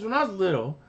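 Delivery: high little kid cartoon voice; Carbonate voice. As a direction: confess it like a small kid. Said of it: "He says".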